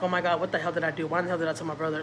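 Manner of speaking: quiet inner panic. Retold or imitated. Panicked inner thoughts, retold.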